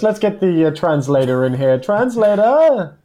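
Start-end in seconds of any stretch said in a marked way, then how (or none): 1.8-3.0 calling out. Pretend. King calls for translator.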